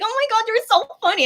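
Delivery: high, gushing fangirl squeal. Retold or imitated. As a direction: squeal it like you can't stop laughing at him. Gushing over his funny reaction.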